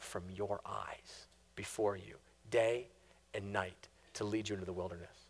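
soft, unvoiced whisper of a promise; hushed divine promise. Preacher voicing God's promise to Israel, whispered. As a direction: breathe God's promise like a secret.

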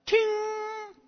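high sung ting like a light switching on; light bulb ting. Retold or imitated. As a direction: make the sound of a light bulb going on. Light going on sound.

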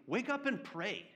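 high, pressing wake up and pray; urgent wake-up call. Pretend. Captain shaking Jonah awake, high and urgent. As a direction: shake him awake with your voice. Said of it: "captain who's shaking him, saying".

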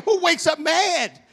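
high-pitched, baffled exclamation; incredulous outcry. Acted. Preacher questions sour congregation, loudly incredulous. Incredulous reaction to her attitude.

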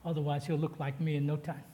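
ordinary delivery

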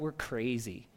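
ordinary talk

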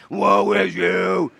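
very slow, drawn-out shout; fired-up prophet. Shown as a demonstration. Preacher showing the church how to say it, hammed-up prophet shout. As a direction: show them the prophet's long bellow. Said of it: "you gotta say it like I do".

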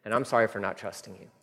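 low, soft, quick admission; contrite confession. Acted. Preacher ending his prayer of apology to God, quietly contrite. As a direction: confess it humbly under your voice.

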